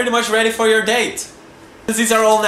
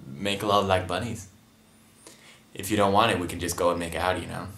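low, soft seductive voice; flirty; 11 semitones lower, 7 dB quieter than usual. Pretend. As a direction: flirt with the camera in a low croon. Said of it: "Flirt with the camera".

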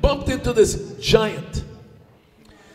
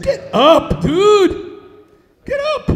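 exasperated order; 10 semitones higher, 5 dB louder, much slower than usual. Acted. Great Spirit orders giant up.

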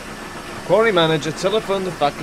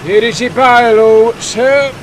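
loud, high, slow official voice; stern inspector; 7 semitones higher, 10 dB louder, slower than usual. A narrator's reading. Inspector citing a bylaw to the quarry manager, stern. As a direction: lay down the law, loud and drawn out. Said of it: "said the inspector sternly".

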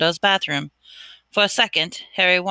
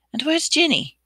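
worried; 9 semitones higher than usual. Acted. Harry's worried inner question.

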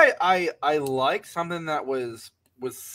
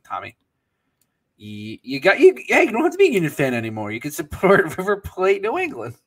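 mock teasing; 4 semitones higher, 5 dB louder than usual. Teasing message to River Plate fan.

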